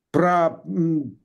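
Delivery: normal talking voice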